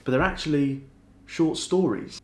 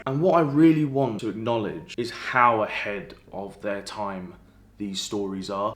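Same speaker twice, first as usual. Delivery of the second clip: normal talking voice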